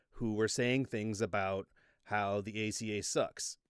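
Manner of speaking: ordinary delivery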